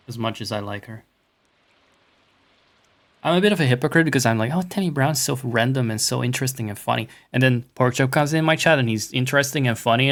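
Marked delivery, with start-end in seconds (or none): 3.2-10.1 loud, rapid-fire confession; mock rant. Streamer admitting hypocrisy about Porkchop in chat, loud mock rant. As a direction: blurt it out loudly in a rush.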